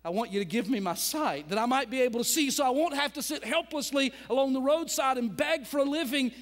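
loud, high earnest plea; pleading request. Acted. Blind man asks Jesus for his sight, loud earnest plea. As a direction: beg for sight with full heart. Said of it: "the blind man said unto him".

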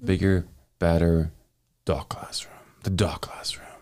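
mock announcer tag, dropping to a whisper; podcast promo voice. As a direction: sell it like a trailer. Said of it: "he just does it so much better".

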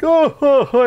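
high, loud "ho-ho" chuckle; mock henchman laugh. Henchman dummy laughing along with his evil boss, mock chuckle. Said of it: "he's laughing evilly, and Rocky's kinda like".

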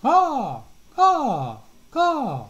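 high, loud cawing chant; cawing crows. A narrator's reading. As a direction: crows chant caw to the dance. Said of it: "slow, steady chant".

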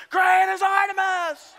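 long, high shouted chant; screaming along. Acted. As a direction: belt out the slogan slowly, high and loud. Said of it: "people screaming and yelling".

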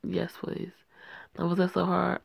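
usual speaking voice

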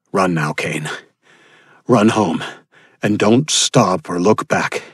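ordinary talk, nothing marked

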